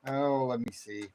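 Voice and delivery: ordinary talk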